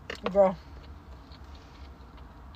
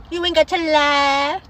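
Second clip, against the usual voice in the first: loud, higher, drawn-out tease; playful teasing; 5 semitones higher, 5 dB louder, slower than usual. Real, not acted. Teasing a friend, just kidding: playful, drawn-out call-out. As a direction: stretch it out slowly and loudly, teasing.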